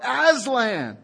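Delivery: high, glad shout of the name; joyful yell. A narrator's reading. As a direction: call out his name in joy. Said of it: "yelling out".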